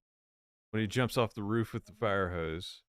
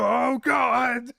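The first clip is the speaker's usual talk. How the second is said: put-on much higher, louder California-accent yelp of "Oh, God!"; fake California accent; 10 dB louder than usual. Villain hiding his accent with a startled fake California yelp. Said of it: "he's got some California accent".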